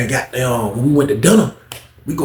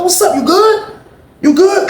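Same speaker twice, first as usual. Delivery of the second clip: very high, louder, pushy concern; high fussing; 4 dB louder, slower than usual. Retold or imitated. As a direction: crowd him with high, loud questions. Bro grabs Paul's shoulder.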